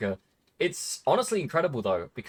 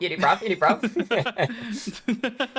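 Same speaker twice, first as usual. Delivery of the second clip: higher-pitched British street challenge; mock British hooligan; 6 semitones higher, much slower than usual. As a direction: play at picking a fight, bruv-style.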